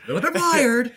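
loud, high-pitched mock yell; echoed freak-out yell. Co-host echoing the favorite movie line, yelled high. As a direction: shout it back as a wired imitation. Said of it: "moviegoers are expecting him to yell at some point".

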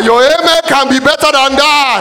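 preacher shouting for a bigger amen; shouted demand. Real, not acted.

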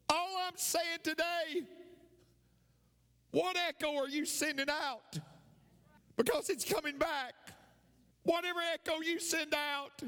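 high, sustained preaching call; rousing preacher cry. Real, not acted. Preacher driving the echo lesson home, rousing high call. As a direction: proclaim it high, pausing between phrases.

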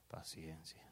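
slow hush on the last word; whispered. Acted. Preacher drops to a whisper.

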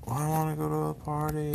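high mocking whiny girl voice; high, scornful impression of the girlfriend; mocking whine. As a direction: mimic her like a sulky child. Mocking girlfriend's reluctant reply.

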